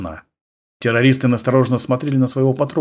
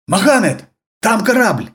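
high, loud alarmed yell; 11 semitones higher, 4 dB louder than usual. Acted. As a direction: cry out a warning. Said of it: "раздался неожиданный вопль".

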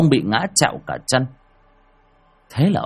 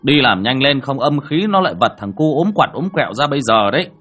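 loud, brisk orders called from outside; calling inside; 3 semitones higher, 5 dB louder than usual. Acted. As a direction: raise your voice to instruct someone indoors. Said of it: "nói vọng vào". (nói vọng vào, giọng to)

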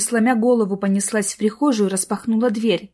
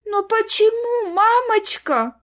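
put-on high, drawn-out child's wail; whiny little girl about to cry; near tears; 13 semitones higher, slower than usual. A narrator's reading. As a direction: plead with mum, stretching each word. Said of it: "чуть не заплакала Сонечка".

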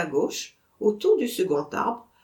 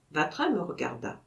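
ordinary delivery

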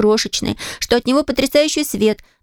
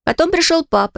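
plain reading voice

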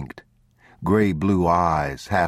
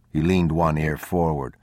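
usual speaking voice

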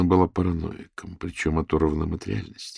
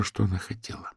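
plain reading voice

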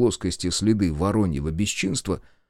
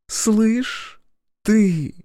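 high, sweetly menacing Masha; mock-tender; 12 semitones higher, 3 dB louder than usual. A narrator's reading. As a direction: coo it like a threat. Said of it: "нежно произнесла Машка".

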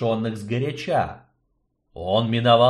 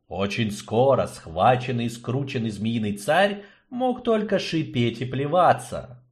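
ordinary delivery

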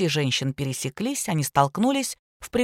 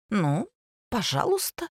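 hesitant, grudging consent with a pause; obvious reluctance; 3 semitones higher, 3 dB quieter than usual. A narrator's reading. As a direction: agree unwillingly, voice lifting at the end. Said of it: "с явной неохотой согласилась Ника".

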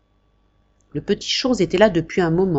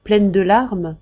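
usual speaking voice

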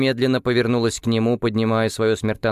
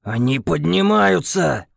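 slow, raised growl; growling Krem; 6 semitones higher, slower than usual. A narrator's reading. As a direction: growl the warning. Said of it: "прорычал Крэм".

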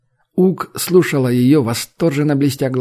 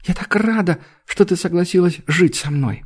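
put-on little girl voice; 6 semitones higher than usual.

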